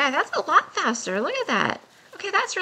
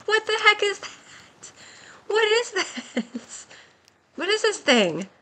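high-pitched, stunned bafflement; can't believe what just appeared, voice pitched right up; baffled; 9 semitones higher than usual. Real, not acted. Player baffled by a thing like a bomb, high and astonished.

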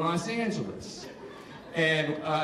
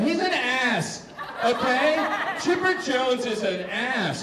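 knowing dismissal; 7 semitones higher, slower than usual. Retold or imitated. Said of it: "I'd be like".

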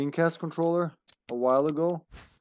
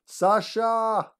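calling out; 9 semitones higher than usual. Real, not acted. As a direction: shout her name across the house. Calling to someone in another room.

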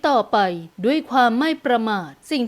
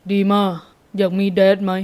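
low-pitched man's voice; father, bracing himself; 4 semitones lower than usual. A narrator's reading. Father daring to ask his daughter, low male voice. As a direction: ask the child carefully, as her father. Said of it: "กลั้นใจถามลูกสาว".

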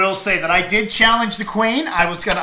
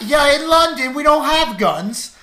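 higher-pitched, hammed-up British accent; bad British accent; 8 semitones higher, 4 dB louder than usual. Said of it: "I like your British accent. That was terrible".

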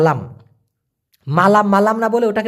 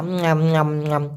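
soft lower munching "ঞ্যাম" sounds; quiet mock chewing sounds; imitated chewing; 5 semitones lower, 4 dB quieter than usual. Shown as a demonstration. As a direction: mime chewing with soft nyam noises. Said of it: "Kunja".

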